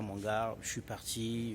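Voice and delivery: normal talking voice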